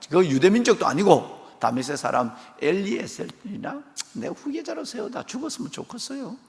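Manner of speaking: sulky, grumbling complaint; Abraham sulking to God about no heir; grudging Abraham. Acted. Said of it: "찝찝해서".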